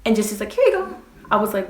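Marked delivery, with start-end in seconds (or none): 0.5-1.0 high-pitched, chirpy handover; mock breezy boyfriend. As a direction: imitate him presenting a gift, pitched way up. Imitating boyfriend casually handing over cat.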